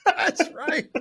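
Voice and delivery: high laughing voice. Real, not acted. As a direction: giggle through the words.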